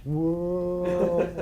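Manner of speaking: stretched out, slowed-down whoa; slow-motion voice. Acted. Slow-mo shot described.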